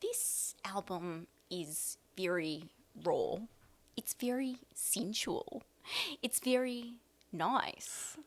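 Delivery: slow breathy whisper; [name] impression. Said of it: "she'll be like".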